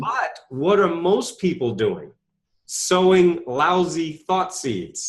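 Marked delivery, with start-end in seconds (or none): 2.6-5.1 slow emphasis. Real, not acted. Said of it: "emphatic slow point".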